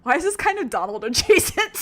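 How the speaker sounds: usual speaking voice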